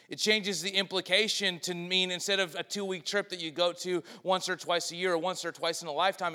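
ordinary delivery

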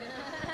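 shaky embarrassed giggle; nervous laugh. Real, not acted. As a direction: laugh off the nerves. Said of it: "Sorry, I'm nervous".

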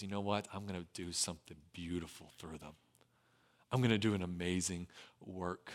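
hushed and gentle, almost a whisper of promise; soft tender God voice. Acted. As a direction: speak it softly like a loving father. God speaks tenderly over failures.

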